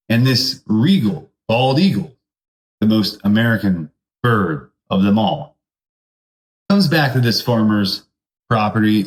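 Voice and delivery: ordinary talk, nothing marked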